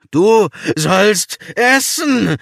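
loud, slow, high bellow. A narrator's reading. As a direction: roar it out slowly. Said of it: "brüllte er".

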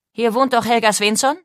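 official questioning. A narrator's reading. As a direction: demand confirmation as an officer. Policeman interrogates the household. (laut und fordernd gefragt)

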